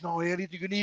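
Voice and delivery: low, very soft helper's voice; hushed, gentle retelling; retold kindly reassurance. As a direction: imitate a nice man reassuring someone. Voicing the kind helper.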